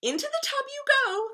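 very high, loud command; bossy nanny. A narrator's reading. Nanny sending the princess to bathe, bossy commanding. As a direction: order a child about in a high, loud voice. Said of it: "the nanny would say".

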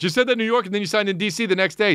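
high, rapid-fire tattling; mock tattletale. Mocking a fan who called him out, high tattletale voice. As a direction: mimic a tattletale, quick and high-pitched. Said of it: "Such a tattletale".

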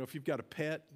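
normal talking voice

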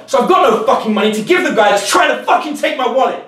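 high, loud furious rant; high-pitched loud mock tirade; acted rant. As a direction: rant at the mugger like you've lost everything. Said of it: "you instantly get aggressive".

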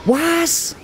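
high startled yelp; shocked disbelief. Real, not acted. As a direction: squeak out the what. Shocked by game event.

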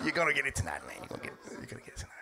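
menacing whisper; a low-volume unvoiced whispered threat. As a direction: hiss the threat under your breath.

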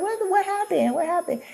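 high, soft questioning; high-pitched mock concern; retold alarm. Acting out her alarmed reply.

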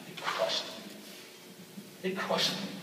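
slow, breathy, broken; hurt whisper. Real, not acted. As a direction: let the words barely come out. Betrayal by friends.

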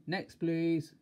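a touch of a holler; mild shout. A narrator's reading. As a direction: call out for the next customer. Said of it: "roared the milkman".

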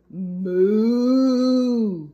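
loud mooing cow. Acted. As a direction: moo like a cow.

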